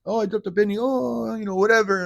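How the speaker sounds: high, hammed-up Yiddish accent. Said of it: "Hebrew accent or whatever accent".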